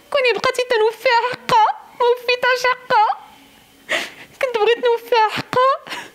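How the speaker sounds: sobbing out her grief and outrage; angry crying. Real, not acted. Sister grieving her murdered sister. (بكاء ممزوج بالغضب)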